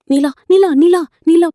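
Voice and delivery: desperate repeated shout; urgent calling out. Acted. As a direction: call the name urgently to stop them. Calling after departing Nila, begging.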